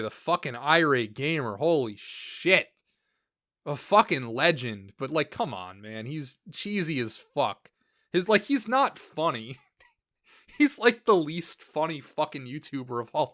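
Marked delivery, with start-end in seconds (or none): none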